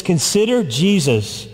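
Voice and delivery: normal talking voice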